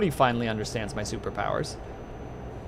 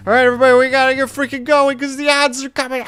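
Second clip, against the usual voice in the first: high, loud hurried call; 11 semitones higher, 10 dB louder, faster than usual. Real, not acted. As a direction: shout to the whole chat. Rushing before ads.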